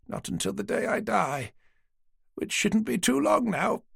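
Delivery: put-on an old lady patiently humouring the police; elderly lady. Dorothy recalling his eyes.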